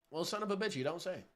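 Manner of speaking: ordinary delivery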